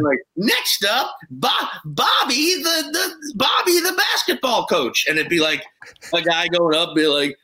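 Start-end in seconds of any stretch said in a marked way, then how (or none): 0.3-5.0 emcee announcement. Retold or imitated. Emcee announcing next comic.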